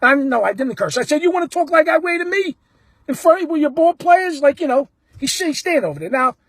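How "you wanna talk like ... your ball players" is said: higher-pitched indignant heckle; imitated fan taunt; retold razzing. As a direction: challenge the player in front of his team. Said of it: "Now I start razzing".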